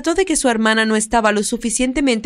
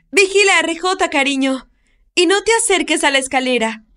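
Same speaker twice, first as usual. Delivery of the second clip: higher call across the garden; 7 semitones higher than usual. Acted. Woman shouts to the girl while running for the phone, higher call. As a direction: call back over your shoulder. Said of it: "le gritó a Mandy".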